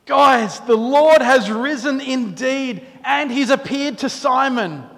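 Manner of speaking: breathless good news. Acted. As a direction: burst in to tell friends the Lord is risen. Disciples bursting in with news.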